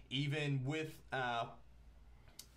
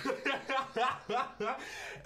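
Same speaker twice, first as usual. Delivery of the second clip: long high-pitched laugh; helpless laughter at yourself; real laughing fit, pitched high; 12 semitones higher, 4 dB louder than usual. Real, not acted. Laughing before attempting the accent.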